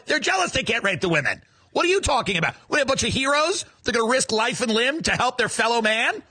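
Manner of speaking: ordinary talk, nothing marked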